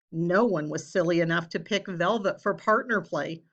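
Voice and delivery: usual speaking voice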